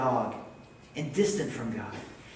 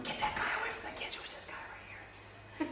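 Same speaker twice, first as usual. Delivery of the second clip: hushed, fast, then slowing whisper; worried whisper; 12 dB quieter than usual. Retold or imitated. Church sponsors eyeing the homeless stranger, anxious whisper. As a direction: whisper it so the stranger can't hear. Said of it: "And the sponsors are like".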